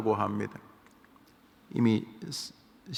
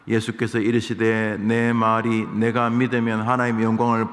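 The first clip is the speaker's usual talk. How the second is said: louder, measured reading of the Bible; a preacher's louder Bible reading; scripture reading; 6 dB louder than usual. Acted. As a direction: read the verses out to the congregation. Reads scripture aloud.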